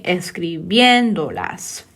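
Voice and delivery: "Escribiéndolas" slowly, stressing the "én"; stress on accented É. Shown as a demonstration. Teacher placing the accent for students, stress on "én" in "Escribiéndolas". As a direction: stretch and punch the "bién" in "Escribiéndolas". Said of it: "put the accent on that E".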